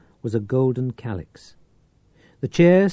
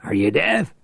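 angry growl; 6 semitones higher, 4 dB louder than usual. Acted. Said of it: "Reich growled".

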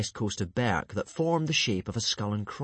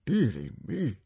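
tutting; 3 semitones higher than usual. Acted. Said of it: "tutted Stoic".

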